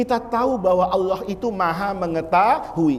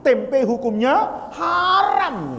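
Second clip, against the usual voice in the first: drawn-out ruling; 3 semitones higher, much slower than usual. Acted. Preacher stretches ruling for effect.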